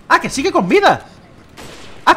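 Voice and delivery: high, loud exclamation of surprise; startled disbelief. Real, not acted. Gamer finding the enemy still alive, startled disbelief. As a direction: blurt it out, pitch leaping up.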